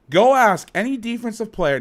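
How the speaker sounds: loud, high, heated challenge; worked up and emphatic; getting hot. Real, not acted. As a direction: punch it out like a rant heating up. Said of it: "I'm getting hot now".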